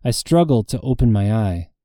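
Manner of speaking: plain reading voice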